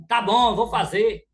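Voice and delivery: loud, irritated "tá bom"; rude grudging reply. Retold or imitated. Husband answering his wife with a loud, rude yes. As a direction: snap back at the wife grudgingly.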